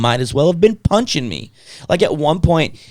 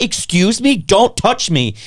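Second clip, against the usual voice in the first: higher, emphatic objection; indignant protest; 7 semitones higher, 3 dB louder, slower than usual. Retold or imitated. Patient to nurse poking his legs, indignant protest. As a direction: push back, pitched up and affronted.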